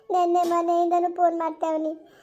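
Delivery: ordinary talk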